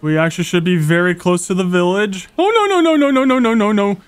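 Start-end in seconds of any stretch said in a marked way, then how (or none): 2.4-4.0 high, rapid string of no's; real gamer panic, pitch falling as it goes; panicked. Real, not acted. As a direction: start high in panic and slide down. Spots a mountain lion in game.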